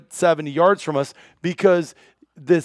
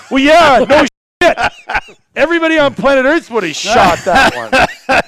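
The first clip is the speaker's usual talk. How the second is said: much higher, louder laughing shout; laughing outburst; 7 semitones higher, 6 dB louder than usual. Real, not acted. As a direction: burst out laughing in disbelief. Laughing outrage at buddy's remark.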